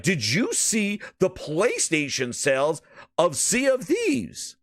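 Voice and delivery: slow, over-enunciated mock executive; ultimate clarity. Host imitating Satya boasting of PlayStation sales, ultimate clarity. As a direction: spell out each word grandly, lifting on Thieves. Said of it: "saying PlayStation with ultimate clarity".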